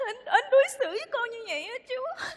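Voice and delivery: crying. Acted. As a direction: sob out a wounded grievance. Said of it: "À thôi nín đi con".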